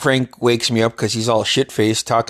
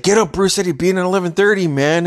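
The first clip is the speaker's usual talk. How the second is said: raised mock urgent voice; lightly voiced friend rousing him; friend's wake-up call; 7 semitones higher than usual. Friend waking roommate, retold.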